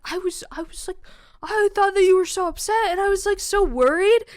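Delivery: high, loud mock distress; mortified. Roommate who thought she was really upset, mortified. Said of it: "He was mortified".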